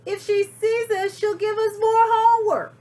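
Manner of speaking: high, loud alarmed voices; panicky kids. Acted. Kids spotting Miss Swamp, high and panicked. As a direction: blurt it in a fright. Frightened kids see witch.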